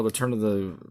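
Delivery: usual speaking voice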